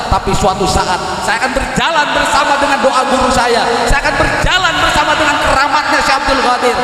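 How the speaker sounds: a scorned man swearing he will rise, voiced with growing fire; defiant vow. Scorned man vowing to the woman who left him, defiant. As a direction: throw the vow back at the one who left, lightly acted.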